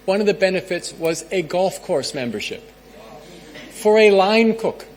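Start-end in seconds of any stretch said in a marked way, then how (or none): 3.7-4.8 slow, higher and louder, drawn out in disbelief; can you believe it, for a line cook; incredulous emphasis. Real, not acted. To the audience, marvelling at line cook perks, incredulous drawn-out emphasis.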